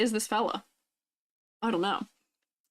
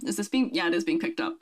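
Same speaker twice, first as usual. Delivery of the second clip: ordinary talk, nothing marked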